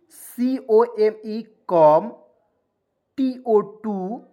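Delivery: usual speaking voice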